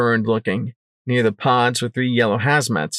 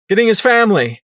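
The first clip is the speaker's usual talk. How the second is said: high, loud shouted answer; yelled back; 10 semitones higher, 6 dB louder, faster than usual. Acted. Narrator answering Psycho Shy, yelling back. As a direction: call it back across the room. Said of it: "I yelled back".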